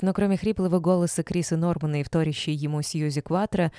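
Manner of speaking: ordinary delivery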